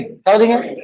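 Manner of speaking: normal talking voice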